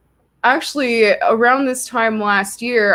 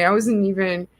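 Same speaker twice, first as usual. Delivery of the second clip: normal talking voice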